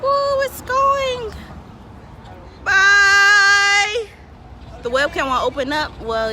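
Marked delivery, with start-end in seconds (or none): 0.0-1.3 high, slow, stretched ooh at the ship leaving; thrilled cry as the ship pulls out; high excited gasp; excited squeal. Real, not acted. 2.6-4.1 high loud bye to the ship; high loud farewell call. Real, not acted. Waving off the ship.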